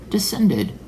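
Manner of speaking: "Descended" starting high and falling. Shown as a demonstration.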